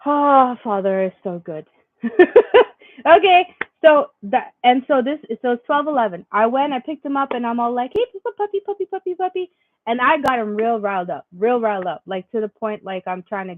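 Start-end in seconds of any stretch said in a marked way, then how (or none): 2.0-2.7 loud happy laugh. Real, not acted. Burst of joy. 8.0-9.5 sing-song high puppy talk. Real, not acted. Mom riling up her puppy, high sing-song baby talk.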